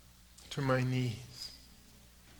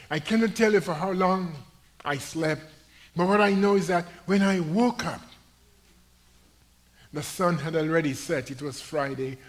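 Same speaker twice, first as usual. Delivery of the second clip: loud ringing pulpit proclamation; full-throated sermon climax; preaching at full force; 5 semitones higher, 11 dB louder than usual. Acted. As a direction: lift the story to the back pews. Preacher building the testimony.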